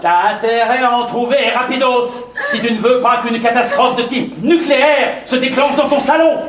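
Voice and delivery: high, insistent warning; exasperated threat. Acted. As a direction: threaten him, temper barely held. Menace l'homme sans cognac. (menace excédée, voix montée)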